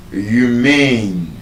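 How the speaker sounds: humane with MANE drawn out; light first syllable, long second in humane; stress on second syllable. Shown as a demonstration. Teacher showing adjective stress, humane weighted on the second syllable. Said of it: "is stressed on the second syllable".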